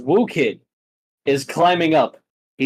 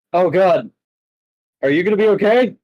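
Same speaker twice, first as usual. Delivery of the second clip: alarmed; 6 semitones higher, 5 dB louder, faster than usual. Acted. Colin sees hurt Abel.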